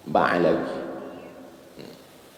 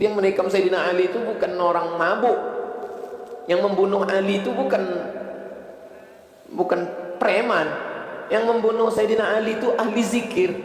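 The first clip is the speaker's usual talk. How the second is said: impassioned high preaching; 13 semitones higher than usual. Real, not acted. Emphatic sermon climax.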